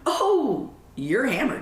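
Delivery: high, loud blurt of surprise. Retold or imitated. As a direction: let it slip out before you can stop. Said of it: "before I could even stop myself".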